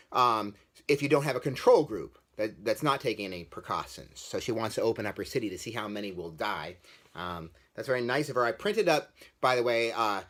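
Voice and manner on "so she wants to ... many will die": ordinary talk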